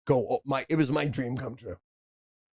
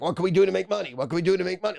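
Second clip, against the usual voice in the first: pushy badgering; 7 semitones higher, much faster than usual. Pushy mobster quoted making a move.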